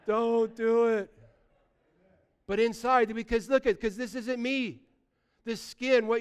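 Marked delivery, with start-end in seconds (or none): 0.0-1.1 drawn-out, high comic plea; body crying out. Acted. Preacher's aging body begs him off the skateboard ramp, slow mock cry. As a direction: stretch the warning out slowly, pleading. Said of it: "my body's just crying out".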